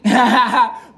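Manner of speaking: loud, high burst of laughter; real laugh. Real, not acted. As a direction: crack up at your own joke. Laughs at his own crude joke.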